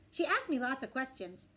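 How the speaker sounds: ordinary delivery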